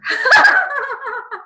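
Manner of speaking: loud, high burst of laughter; high-pitched real laugh. Real, not acted. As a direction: laugh out loud at your own joke. Laughs after her own joke.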